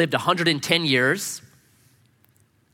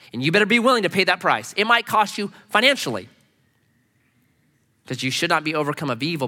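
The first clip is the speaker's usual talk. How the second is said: usual speaking voice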